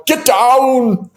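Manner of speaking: high-pitched mock warning yell; retold yell. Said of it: "I was going to yell".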